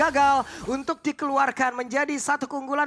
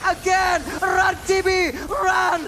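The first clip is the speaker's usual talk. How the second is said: louder, higher fight call; excited shout; 4 semitones higher, 4 dB louder, slower than usual. Real, not acted. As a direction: urge them to run. Fight call, urging run.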